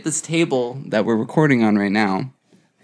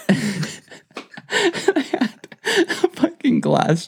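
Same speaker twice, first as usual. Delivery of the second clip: high, squeaky helpless laughter swallowing the words; slow, broken by real giggles; real laughing fit; 13 semitones higher, much slower than usual. Real, not acted. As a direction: try to talk through uncontrollable laughing. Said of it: "you laughed for, like, five minutes".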